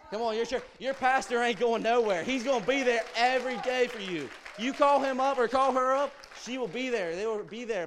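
full-force preaching. Acted. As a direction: preach it out hard to the congregation.

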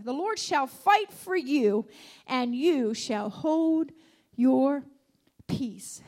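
slow, loud, each word hammered; emphatic declaration. Acted. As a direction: proclaim it slowly and loudly.

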